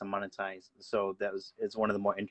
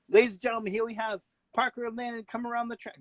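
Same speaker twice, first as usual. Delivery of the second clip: high, fast race announcer call; 10 semitones higher than usual. Pretend. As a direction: boom it out like a track announcer. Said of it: "could I be an announcer voice?".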